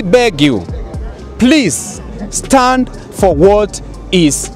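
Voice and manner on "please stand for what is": ordinary delivery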